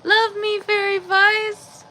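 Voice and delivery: high, slow pet voice. Real, not acted. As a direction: coo it up high to the cat. Baby talk to the cat.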